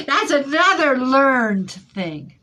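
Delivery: high loud laugh into words; loud giggling aside. Real, not acted. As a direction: laugh out the line. Laughs at own joke.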